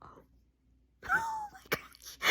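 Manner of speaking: airy laugh into a whispered oh my gosh, very slow; breathless whispered laugh. Real, not acted. Fan overwhelmed by the twist, breathless teary whisper.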